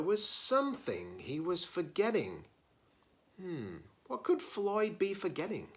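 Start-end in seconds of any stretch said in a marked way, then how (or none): none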